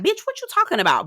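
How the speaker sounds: high, sassy dismissal; Diamond's sass. Retold or imitated. Diamond brushing off Shay, high and sassy. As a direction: toss it off with attitude. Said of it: "Diamond was like".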